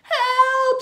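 high, loud panicked shriek; full-voiced terrified squeal; scream. A narrator's reading. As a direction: scream for rescue like a small child. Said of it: "screamed the twins".

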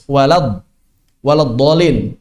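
"Walad dolin" with a heavy d; thin and thick letters. Shown as a demonstration. Teacher showing learners a tajwid example, "dolin" with a thick d. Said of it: "tipis tebal, tebal tipis".

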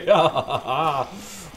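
short rising relieved laugh; a burst of laughter in relief. Real, not acted. Laugh after the escape.

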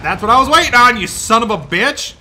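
high, very loud outburst at a scare; startled shout. Real, not acted. Player hit by the scare he expected, startled shout. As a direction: yell at the thing that just got you. Jump scare he was waiting for.

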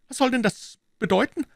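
very high anxious character voice. A narrator's reading. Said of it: "fragte er ängstlich". (ängstlich, mit hoher Stimme)

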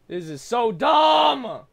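slow, high furious yell; raging gamer. Real, not acted. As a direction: blow up at the game. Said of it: "shouted take".

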